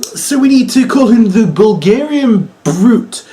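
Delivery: mock-proud announcer pitch. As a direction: boom it out like a proud writer. Mocking the announcers' naming logic.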